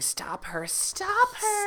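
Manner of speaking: tiny possessed dolls chanting; creepy doll voices. Acted. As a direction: doll voices rising to a squeak. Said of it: "the dolls are saying".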